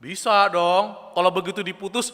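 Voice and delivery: usual speaking voice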